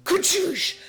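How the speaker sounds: mock explosion sound. Retelling explosion scene.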